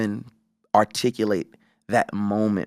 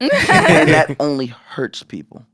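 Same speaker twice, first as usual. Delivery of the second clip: slow, louder point said through a laugh; 5 semitones higher, 7 dB louder, much slower than usual. Real, not acted. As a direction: laugh it out, slowly. Laughs while making point.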